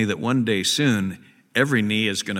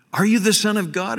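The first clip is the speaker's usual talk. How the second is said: challenging question; 10 semitones higher, 4 dB louder than usual. Retold or imitated. Authorities question Jesus.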